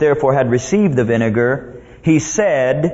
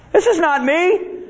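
high, mock-alarmed voice; panicking devil; 13 semitones higher, slower than usual. Devil seeing Jesus control the circumstances, mock panic. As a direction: protest high, as control slips away.

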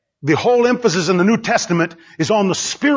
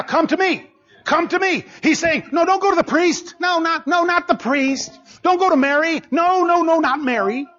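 Jesus calling out; 7 semitones higher than usual. Acted. Jesus calling everybody right now.

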